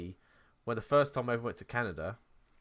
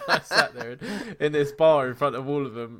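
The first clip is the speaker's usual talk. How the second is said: laughing loudly through the words; giggling at her own cliche; 5 semitones higher, 12 dB louder than usual. Real, not acted. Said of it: "You just become a cliche".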